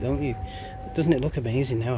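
usual speaking voice

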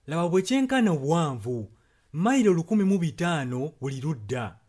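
ordinary delivery

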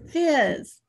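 "Fizz" said high and loud, the z buzzing; emphatic fizz. Shown as a demonstration. Teacher cheerfully belting "Fizz" for the child. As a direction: pop the word out like a fizzing soda. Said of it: "Do you like the fizz".

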